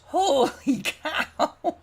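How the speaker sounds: slow, loud, high laugh through the words; laughing disbelief. Real, not acted. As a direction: laugh it out in amazement.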